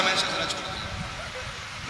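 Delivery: a hushed, teasing threat; whispered mock threat. Preacher teases the crowd to keep chanting, whispered slowly. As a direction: whisper it softly and slowly as a playful warning.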